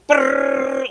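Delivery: high, loud brrr of machine-gun fire; gunfire imitation. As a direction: rattle it out like a gun. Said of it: "like this".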